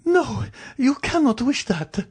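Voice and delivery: very high, slow wail of disbelief; horrified high-pitched woman's voice; vociferated protest. Acted. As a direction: plead against it in a high, drawn-out cry. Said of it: "she vociferated".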